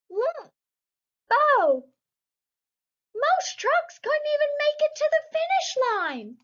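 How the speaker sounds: high, excited cartoon voice; high cheering character. Brothers see him finish, high excited cartoon voice. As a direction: cheer him over the line.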